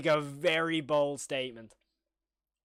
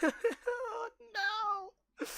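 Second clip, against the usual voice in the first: high laugh that cracks into a wail; laugh-cry in disbelief. Real, not acted. Viewer dreading the next horror clip, laugh-crying.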